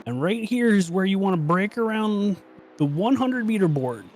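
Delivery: rising, building race call as the car comes round; race play-by-play. Acted. Commentator calling the lap to viewers, building excitement.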